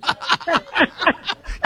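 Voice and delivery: a big high-pitched laugh breaking out; delighted burst of laughter. Real, not acted.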